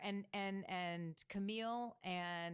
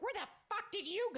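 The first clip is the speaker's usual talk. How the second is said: put-on high shrill drunk demand; drunk angry shriek; 13 semitones higher than usual. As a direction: shriek it, tipsy and annoyed. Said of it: "She's like".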